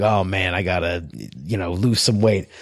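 imitating an uncle's super deep voice; low-pitched uncle impersonation; Uncle Joe. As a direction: drop low to mimic a very deep-voiced man. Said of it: "his voice is super deep".

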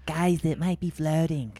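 loud projected stage whisper. Shown as a demonstration. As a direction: say it like a whisper meant for everyone. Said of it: "Stage whisper".

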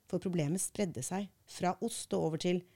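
ordinary delivery

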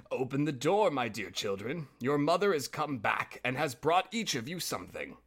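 ordinary delivery, nothing marked

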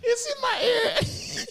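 high squealing laugh-voice panic; panicked squeal. Retold or imitated. Kid squealing to friends about beans in ear.

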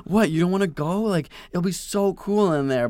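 high-pitched teasing persuasion; mock coaxing. Coaxing scared little brother in story.

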